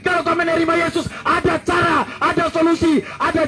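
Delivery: high, ringing preacher's cry building up; fiery preaching. Acted. Preacher calling sinners to Jesus, fiery high preaching. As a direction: call every sinner in.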